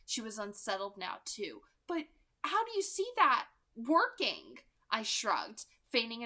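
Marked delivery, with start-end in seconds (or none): 1.9-4.6 1 challenging Rosalie. Acted.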